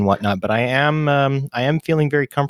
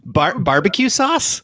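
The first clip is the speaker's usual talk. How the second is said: much higher, slow puzzled "barbecue sauce?"; baffled; 9 semitones higher, slower than usual. Real, not acted. Guest asking in disbelief about the random blurt. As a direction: ask it in total disbelief.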